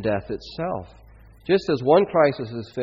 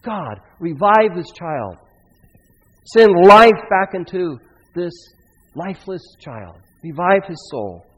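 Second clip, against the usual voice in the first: pleading prayer, swelling loud then dropping; crying out; 4 semitones higher, 9 dB louder than usual. Acted. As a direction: beg God for the child's life. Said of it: "he cries out to God".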